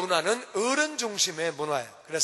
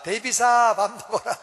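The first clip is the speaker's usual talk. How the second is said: loud call of the name; mother calling out; 3 semitones higher, 6 dB louder than usual. Retold or imitated. Mom calls child by name to dinner, loud. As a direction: call the second kid to eat. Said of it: "야자를 꼭 붙입니다".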